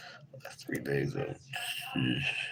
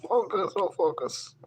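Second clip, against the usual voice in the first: high-pitched urging, louder than usual talk; high, loud plea; 13 semitones higher, 7 dB louder than usual. Real, not acted. Talking to the camera that won't focus, high loud plea. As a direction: call out much higher and louder.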